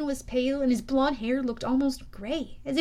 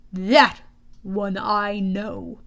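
low, slow growl; 3 semitones lower, 5 dB louder, much slower than usual. A narrator's reading. General recognizing an enemy, growling. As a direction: growl it with menacing weight. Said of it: "the general growled".

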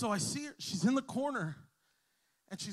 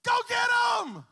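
high, loud rallying yell; shouted; 9 dB louder than usual. Retold or imitated. Pastor urging the girl to attack, shouting. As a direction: fire her up with one big shout. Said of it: "And then I said".

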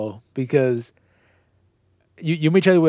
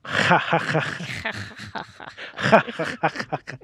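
exaggerated throaty, whispered ha-ha-ha; hammed-up laugh with a rasped Spanish j; voiceless mock cackle from the throat; mock Mexican laugh; 4 semitones higher, much slower than usual. Shown as a demonstration. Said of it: "I think more of a Mexican".

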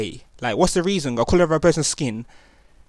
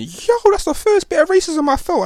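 much higher, stunned disbelief; shocked inner thought; 3 dB louder than usual. Retold or imitated. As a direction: voice jumps up in shock. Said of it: "And I'm thinking".

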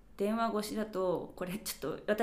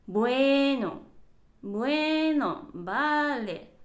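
slow, stretched, resigned; oh well, fine then; drawn-out resigned bueno; 4 semitones higher, 7 dB louder, much slower than usual. Shown as a demonstration. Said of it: "私はこう言っちゃってる".

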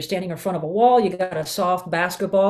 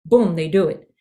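normal talking voice

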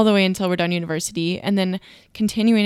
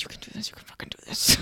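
whispering urgent self-encouragement; hushed, imitated talking to yourself; whispered pep talk; 7 dB quieter, faster than usual. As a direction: psych yourself up under your breath. Retells private morning pep talk.

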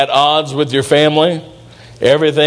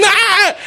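imitated baby shriek, much higher; baby's scream. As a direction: let out a happy toddler scream. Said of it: "I think I'm gonna scream".

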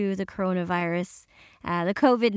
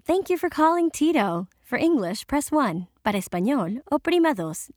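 bright automated-greeting read, pitched up; phone menu voice; 5 semitones higher than usual. Shown as a demonstration. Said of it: "and you hear".

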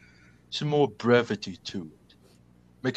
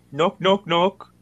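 louder, higher calling; called out knock; 5 semitones higher, 4 dB louder, slower than usual. Acted. Knocking on the door, calling out louder. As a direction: announce yourself at the door.

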